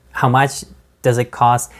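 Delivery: ordinary talk, nothing marked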